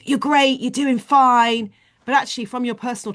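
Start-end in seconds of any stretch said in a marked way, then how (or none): 0.1-1.7 high, sing-out praise, drawn out; cheerleading. Acted. Cheerleader only praising, never challenging, upbeat cheer. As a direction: cheer them on brightly, higher and louder. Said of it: "We just cheerlead".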